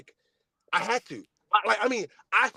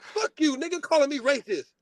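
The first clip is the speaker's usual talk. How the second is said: angry; 6 semitones higher than usual. Retold or imitated. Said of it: "I'm like".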